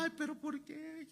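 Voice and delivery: high, whiny mock lament; mock crying. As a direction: wail it like someone pretending to cry. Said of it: "estaríamos llorando en la cara".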